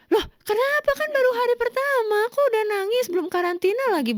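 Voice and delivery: high-pitched puzzled protest, easing down; retold bafflement. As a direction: imitate a baffled boyfriend. Quotes boyfriend reacting to her crying.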